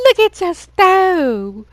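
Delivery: very high, loud and slow; amazed gawking. Pretend. Friend staring at the burnt stove, high, loud and slow.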